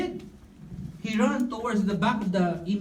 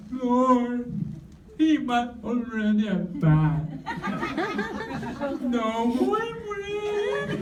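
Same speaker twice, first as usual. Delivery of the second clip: mock nasal crying prayer; 3 semitones higher, slower than usual. (pabirong ngongong pagmamakaawa sa Panginoon)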